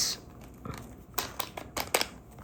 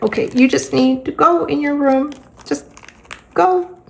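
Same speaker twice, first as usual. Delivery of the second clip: higher, strained go-away plea; exasperated; 7 semitones higher than usual. Retold or imitated. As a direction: beg him to just go, higher. Exasperated mom sends son away.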